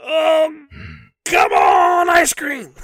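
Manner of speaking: high, loud hawker shout; shouted ice cream call. Retold or imitated. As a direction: yell it across the restroom. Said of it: "I'm like / Start screaming it".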